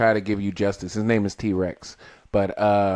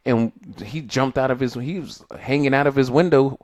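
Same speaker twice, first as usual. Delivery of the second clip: normal talking voice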